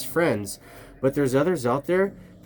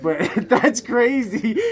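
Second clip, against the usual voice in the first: laughing; 8 semitones higher, 4 dB louder, slower than usual. Real, not acted. Laughs off the mistake.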